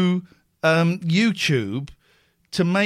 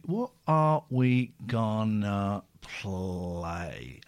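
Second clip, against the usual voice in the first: a lowered, slowed-motion take; slowed-down deep voice; 10 semitones lower, 7 dB quieter, much slower than usual. Shown as a demonstration. Host stalling while picking a song, slow and low. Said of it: "repeats it slowed and deep".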